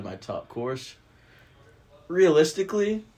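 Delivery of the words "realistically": "Realistically" jumps high and loud; eager high "Realistically" before the correction; excited blurt. Real, not acted. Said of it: "not realistically".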